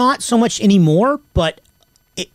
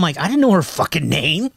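usual speaking voice

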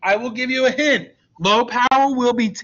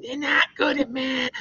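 mock colleague; 5 semitones higher, 4 dB quieter than usual. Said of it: "She's like".

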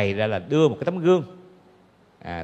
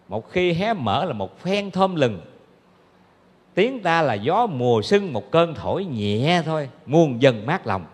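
measured, lilting recital of praise; slow verse recitation; slower than usual. Acted. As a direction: chant the lines slowly like a devotional poem.

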